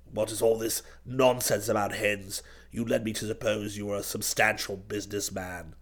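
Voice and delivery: very low, soft reproof; scolding old duke. A narrator's reading. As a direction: cut in with quiet disapproval, deep in the voice. Voices duke scolding nephew.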